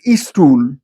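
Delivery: "istool" said with a leading i before the s-t; Indian English stool. Shown as a demonstration. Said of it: "stool is pronounced as istool".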